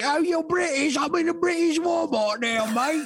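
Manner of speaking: high, hammed-up British accent; mock Brit with a "mate" on the end; cartoon British shopper voice. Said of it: "She's like".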